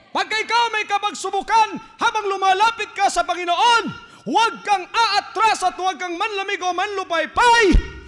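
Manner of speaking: high, loud exhortation; fiery pastor's plea; preaching at full force. Acted. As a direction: urge them on, never retreat.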